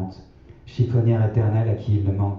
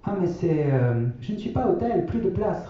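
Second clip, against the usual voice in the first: vieille dame gênée, voix aiguë et hésitante; hésiter, embarrassée, dans une voix haute; grand-mère gênée; 10 semitones higher, 3 dB quieter than usual. A narrator's reading. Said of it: "répond ma grand-mère gênée". (grand-mère gênée qui avoue ne pas être à l'hôtel, voix aiguë)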